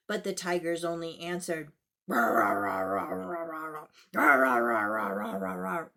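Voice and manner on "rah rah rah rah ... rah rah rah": deep growling rahs; tiger growls. Acted. Tigers growling through bitten tails, deep growl. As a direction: growl with a tail in your mouth.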